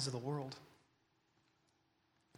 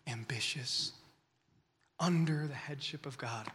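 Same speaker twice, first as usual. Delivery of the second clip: ordinary talk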